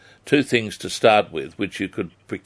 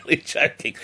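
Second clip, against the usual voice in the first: laughing; 7 semitones higher than usual. Real, not acted. Host laughs at his own joke.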